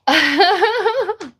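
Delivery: high, shaking laugh; real laughter. Real, not acted. Streamer cracking up at own slip, laughing.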